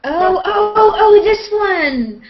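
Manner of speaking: loud, high, drawn-out oh, oh; excited discovery. Real, not acted. Host spots a wild fan comment, excited. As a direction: pounce on the comment you just found.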